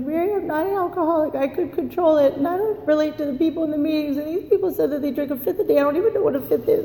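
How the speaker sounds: high-pitched, tearful pleading retold; mock-crying, rushing through excuses in a high voice; crying protest. Newcomer pleading to her sponsor that she isn't alcoholic, crying. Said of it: "I'm crying".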